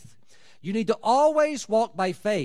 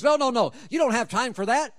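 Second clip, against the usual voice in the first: sudden higher, louder no, no, no; emphatic interruption; 6 semitones higher, 5 dB louder, faster than usual. Real, not acted. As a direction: cut the rambler off sharply. Preacher cuts off rambling prayer.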